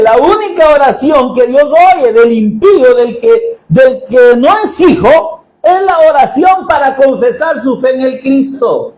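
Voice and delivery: ordinary talk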